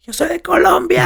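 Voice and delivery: very high-pitched, playful retold yell; high, gleeful mock shout; excited yell. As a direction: imitate her yelling out for fun in the stairwell. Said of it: "yelling, having fun in the stairs".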